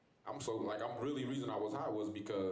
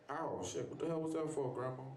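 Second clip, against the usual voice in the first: startled ow; 6 semitones higher than usual. Retold or imitated. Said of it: "I'm like".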